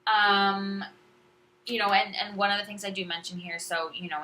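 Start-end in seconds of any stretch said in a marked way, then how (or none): none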